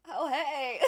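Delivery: a fake-breezy hello at dinner; awkward greeting. Greeting family at dinner, mock-cheery. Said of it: "then be like".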